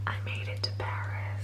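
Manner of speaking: hushed arrival whisper; real whisper, very soft. Real, not acted. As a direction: whisper it so nobody wakes up. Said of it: "We're whispering 'cause his family's asleep".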